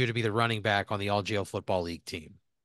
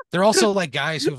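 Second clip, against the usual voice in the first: much higher and louder, still laughing; excited burst; 7 semitones higher, 8 dB louder than usual. Real, not acted. Game master explaining coaches, excited and loud. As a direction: blurt it out mid-laugh.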